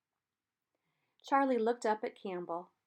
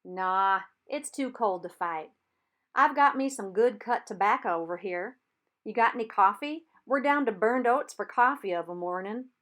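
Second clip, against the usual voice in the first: louder friendly call over the water; calling across; 9 dB louder than usual. A narrator's reading. Reb calls across the river.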